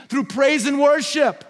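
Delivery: high, slow, shouted climax; preacher shouting the answer to the congregation; preaching shout. Real, not acted. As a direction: drive the point home at full voice. Sermon climax on worship.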